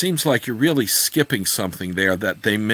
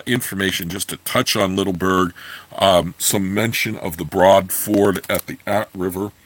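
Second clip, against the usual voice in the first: ordinary delivery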